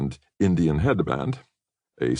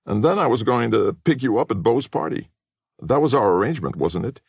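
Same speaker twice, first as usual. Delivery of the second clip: pointed reminder; 8 semitones higher, 3 dB louder than usual. Acted. Rick pressing Katie.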